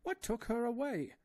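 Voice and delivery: put-on high, anguished old professor; father fearing for his lost daughter, high and distraught. A narrator's reading. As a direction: plead like a frightened old father. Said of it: "cried Professor Porter".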